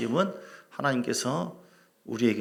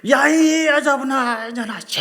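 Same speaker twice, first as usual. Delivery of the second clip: slow, loud, high furious scream; screaming at mother-in-law; 13 semitones higher, 10 dB louder, slower than usual. Retold or imitated. Daughter-in-law screaming on the mountain, furious yell. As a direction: let the pent-up rage burst out. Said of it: "소리 지르고 하니까".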